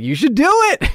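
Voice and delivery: high, loud cheerleading, re-enacted; excited urging. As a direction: egg him on, voice leaping up. Acts out urging star to return.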